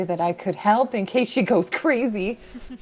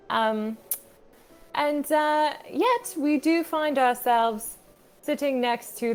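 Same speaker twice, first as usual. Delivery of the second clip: attempted British accent. Player recapping the last session for the group, attempted British accent. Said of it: "worse British accents".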